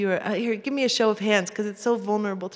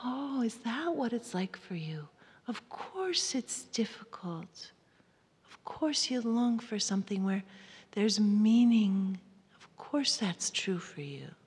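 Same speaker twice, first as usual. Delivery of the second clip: tender; 5 dB quieter than usual. Shown as a demonstration. Wife comforting her husband, soft and hushed. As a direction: soothe him gently. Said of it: "come to my husband and say".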